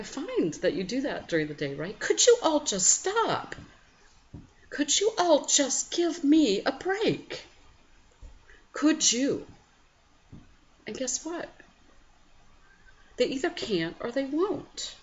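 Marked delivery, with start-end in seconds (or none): 2.0-9.5 much higher and louder, fed-up pleading; loud, high, exasperated please-stop; exasperated yell. Retold or imitated. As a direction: beg the noisy house to stop, half-hamming your frustration. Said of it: "at first I got really irritated".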